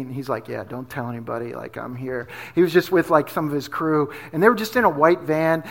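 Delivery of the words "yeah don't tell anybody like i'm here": very low, soft murmur; hushed confidence. Retold or imitated. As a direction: keep it secret, barely above a murmur. Star secretly confides in a fan.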